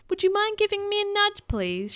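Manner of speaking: put-on high, sweetly polite girl; well-mannered little request. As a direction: ask very politely, starting high. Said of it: "she asked the man politely".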